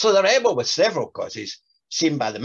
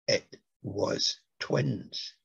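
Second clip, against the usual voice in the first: very slow, softer, composed; calmly; 3 semitones lower, 5 dB quieter, much slower than usual. Acted. The narrator calmly covers for Yvonne with the nurse. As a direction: say it slowly and coolly to save the day. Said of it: "I said calmly".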